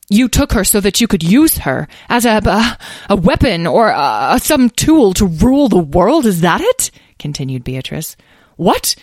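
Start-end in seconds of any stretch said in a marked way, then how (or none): 0.1-6.9 angry accusation. Acted. Dragon mother confronts the guild over her child, angry accusation. 8.6-8.9 higher, louder startled protest; alarmed cry. A narrator's reading. Elderly guild member denies the dragon's accusation, alarmed cry. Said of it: "cried Abner, alarmed".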